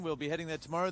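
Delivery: ordinary delivery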